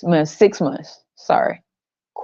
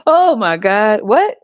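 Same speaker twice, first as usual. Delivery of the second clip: ordinary delivery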